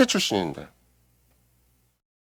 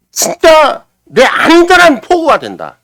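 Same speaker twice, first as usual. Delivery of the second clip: impassioned swell; 10 semitones higher, 8 dB louder, much slower than usual. Acted. Preacher swells on the promise.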